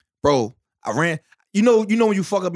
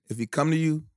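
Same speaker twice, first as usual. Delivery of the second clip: ordinary delivery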